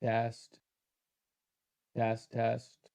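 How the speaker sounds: testing through a glitchy mic; alien voice. Real, not acted. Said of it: "Sounds like I'm an alien".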